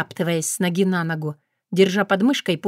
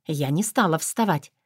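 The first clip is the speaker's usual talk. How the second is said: ordinary delivery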